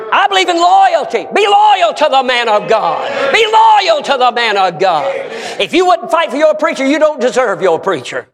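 high, loud preaching shout; full-force exhortation. Real, not acted. Preacher demanding loyalty to the pastor, impassioned high shout. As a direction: thunder it out to rouse the room.

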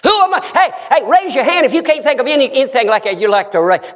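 high, rapid challenge to the congregation; fired-up preaching. Real, not acted. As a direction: call them out urgently from the pulpit.

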